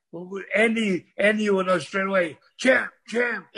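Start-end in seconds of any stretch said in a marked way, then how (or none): 2.6-3.5 imitating someone calling out; mild mock holler; retold yell. Man outside the ring calling the champs, retold yell. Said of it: "he yells out".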